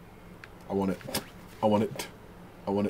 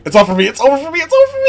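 rising panic; 7 semitones higher, much faster than usual. Real, not acted. Gamer losing the fight, panic rising higher. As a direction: panic climbing higher with each repeat.

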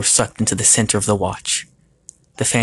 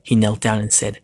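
ordinary delivery

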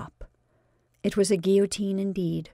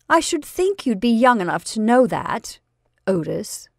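falsely pleasant; 3 semitones higher, 6 dB louder than usual. Acted. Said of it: "continued Marjorie quite pleasantly".

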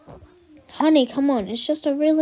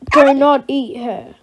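loud, slow command; a parent sternly warning the dog off the daughter; 9 dB louder, slower than usual. Acted.

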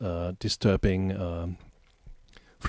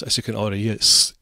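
ordinary delivery

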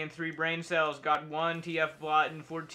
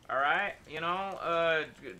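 ordinary delivery

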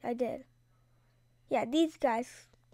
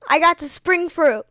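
loud excited announcement; 4 semitones higher, 13 dB louder than usual. Real, not acted. Gamer telling viewers about the rare fruit, loud and excited. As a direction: blurt out the good news.

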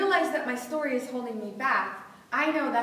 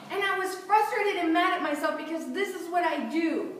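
normal talking voice

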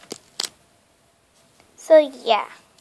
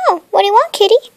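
louder, higher sing-song to the kitty; pet owner; 5 semitones higher, 9 dB louder, faster than usual. Pretend. Owner asking kitten what it wants, louder higher coo.

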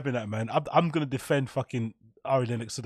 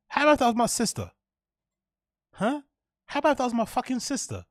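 higher, fast, heated question; indignant challenge; 8 semitones higher, faster than usual. Real, not acted. Streamer confronting a chatter, high and indignant. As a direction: demand an answer from him.

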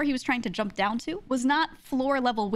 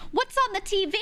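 high, exaggeratedly casual toddler question; hammed-up innocent subject change, pitched high; theatrical kid; 10 semitones higher than usual. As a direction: play a kid pretending to be suddenly curious. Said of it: "He said it just like that".

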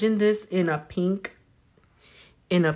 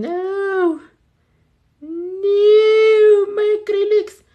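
high, loud panicked "no"; alarmed cry; 14 semitones higher, 10 dB louder, much slower than usual. Real, not acted. Seeing her acrylic paint dry on the brush, panicked high cry. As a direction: yelp as the polish dries. Nail polish drying too fast.

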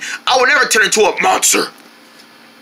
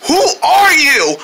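high-pitched, frightened demand; horrified; 8 semitones higher than usual. Acted. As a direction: recoil from a changed friend. Scared of changed friend.

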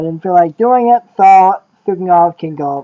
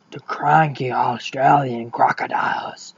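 unvoiced Australian-outback whisper; softly whispered aside; 6 semitones lower, 8 dB quieter than usual. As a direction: hush it like a nature-show secret. Whispered aside about crocodiles.

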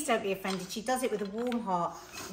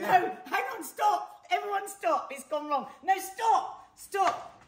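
laughing stop; 10 semitones higher, slower than usual. Real, not acted. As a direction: call everyone to stop while laughing. Calling everyone to stop, laughing.

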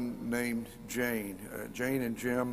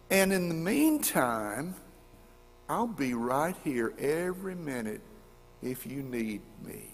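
ordinary talk

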